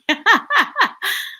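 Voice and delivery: high bubbling chuckle; hearty laugh. Real, not acted. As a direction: burst into a string of ha-has. Laughs after own remark.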